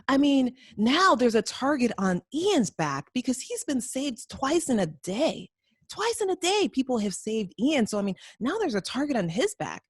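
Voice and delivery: high, swooping mock complaint; hammed-up indignant explaining; acted exasperation. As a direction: imitate someone incredulously arguing his point. Voices Memphis's exasperated complaint.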